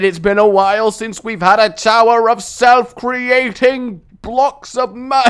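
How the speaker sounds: normal talking voice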